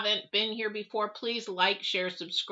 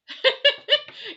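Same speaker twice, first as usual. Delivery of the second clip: high, loud, bursting laugh; real burst of laughter; 8 dB louder than usual. Real, not acted. Laughing at her own reaction to the news.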